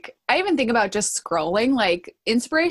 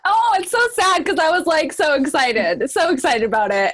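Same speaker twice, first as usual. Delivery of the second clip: laughing; 7 semitones higher, 5 dB louder than usual. Real, not acted. Laughing at lost thought.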